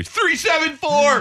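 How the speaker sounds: high, slow barked numbers; coach calling signals. Retold or imitated. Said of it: "coaches are out there".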